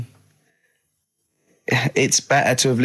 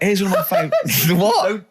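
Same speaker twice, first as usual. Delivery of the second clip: breaking into a high laugh; words overtaken by laughter; 6 semitones higher than usual. Real, not acted. Host cracks up at co-host's silly moral, laughing.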